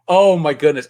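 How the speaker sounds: usual speaking voice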